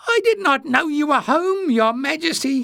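very high, squeaky voice; loud, high-pitched fluster; spluttering. A narrator's reading. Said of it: "he spluttered".

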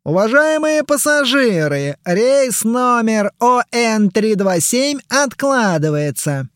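high, slow public-address voice; airport announcement. Acted. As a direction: announce it over the airport speakers.

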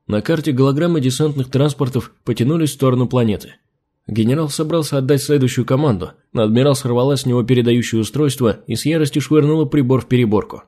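plain reading voice

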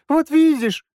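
put-on very high, loud child's squeal; excited squeal. A narrator's reading. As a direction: squeal it like a thrilled kid. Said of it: "возбуждённо завизжал".

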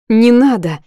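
sharp pleading cry, voice rising; loud tearful protest. A narrator's reading. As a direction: push back hard through tears.

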